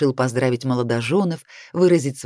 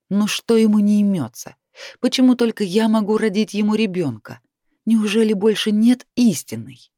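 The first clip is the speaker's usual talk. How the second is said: higher, louder burst of narrated frustration; exasperated; 6 semitones higher, 4 dB louder than usual. A narrator's reading. Heroine fumes about the dragon pursuing her, exasperated and raised. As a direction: fire off indignant questions, pitch raised.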